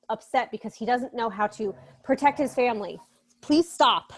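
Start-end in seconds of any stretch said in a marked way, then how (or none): 3.4-4.1 higher, louder telling-off; sharp aside to an interrupter; real exasperated command. Real, not acted. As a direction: break off to tell someone to stop. Snaps at someone interrupting the lesson.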